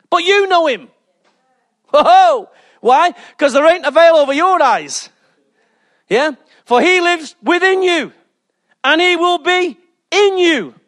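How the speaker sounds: high, exultant preacher delivery; impassioned preaching. Real, not acted. Preacher telling believers they know the Spirit, impassioned.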